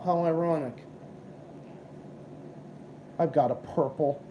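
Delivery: normal talking voice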